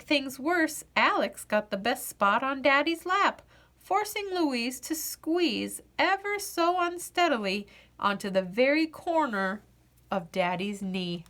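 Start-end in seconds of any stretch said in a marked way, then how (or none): none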